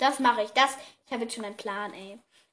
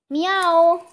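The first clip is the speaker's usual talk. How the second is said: loud, higher, drawn-out meow; imitated cat meow; 5 semitones higher, 9 dB louder than usual. Owner coaxing her silent cat by meowing, imitated meow.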